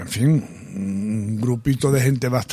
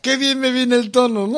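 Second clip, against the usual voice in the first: higher, amused teasing imitation; laughing mock quote; 9 semitones higher than usual. Host teasing the singer about her tone, laughing mock quote. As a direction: playfully mimic it through a laugh.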